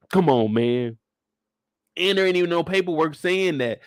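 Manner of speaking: ordinary delivery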